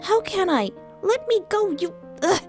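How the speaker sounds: very high-pitched struggling complaint; frustrated protest. Acted. Fia struggles against Lial's hold, high and frustrated.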